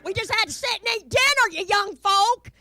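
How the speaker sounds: put-on high, loud old-timer's complaint; mock scolding. Elder telling young folk about dinner before ball pits, loud scolding. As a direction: holler it at the youngsters.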